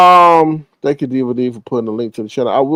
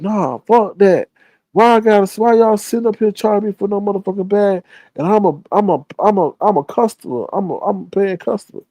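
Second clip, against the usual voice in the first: imitating a pissed-off shopper complaining; irate shopper ranting about the bag charge; angry customer; 7 semitones higher than usual. Retold angry customer at Walmart.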